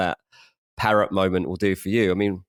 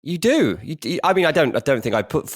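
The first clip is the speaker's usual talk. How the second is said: normal talking voice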